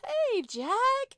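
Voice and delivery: high, loud, caught-out hello; startled high greeting. Real, not acted. Player caught with Sean when Jack appears, high and startled. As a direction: sound caught red-handed.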